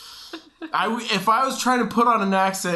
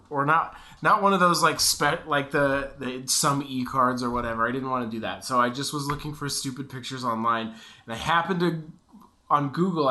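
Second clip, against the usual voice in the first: usual speaking voice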